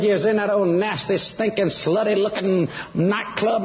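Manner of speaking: high-pitched, disgusted telling-off; mock disapproval, slowing down on the insults; retold scolding mother. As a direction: imitate mama laying into each adjective. Acting out mom's scolding on phone.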